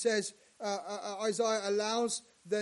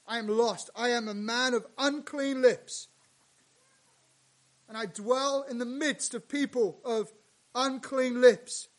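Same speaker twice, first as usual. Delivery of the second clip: loud lament; 3 semitones higher, 7 dB louder than usual. Retold or imitated. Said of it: "She's like".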